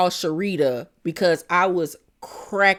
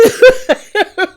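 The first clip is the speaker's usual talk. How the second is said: a shrieking laugh bursting out; loud high cackle; 13 semitones higher, 10 dB louder than usual. Real, not acted. Vlogger cracking up at Mia's insult, with a loud high cackle.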